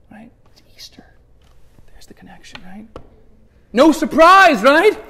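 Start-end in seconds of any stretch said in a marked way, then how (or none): 0.1-2.9 whispered, almost breathless secret; hushed aside. Real, not acted. Preacher to the congregation, whispered hint. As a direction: lean in and whisper the reveal.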